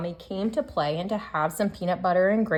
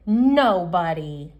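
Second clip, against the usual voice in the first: slow, louder pouty nobody; sulky refusal; 3 semitones lower, 11 dB louder, much slower than usual. Acted. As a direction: drag it out with a pout. Child refuses bedtime story.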